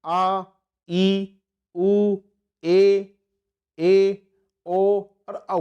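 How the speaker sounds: each long vowel held about twice as long; long vowels recited. Shown as a demonstration. Teacher demonstrating long vowels to students, each drawn out. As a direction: stretch each vowel out steadily. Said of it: "इनका भी देख लेते हैं".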